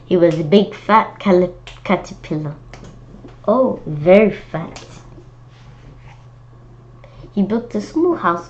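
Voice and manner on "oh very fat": plain reading voice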